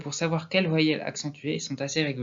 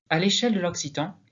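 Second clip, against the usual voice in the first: usual speaking voice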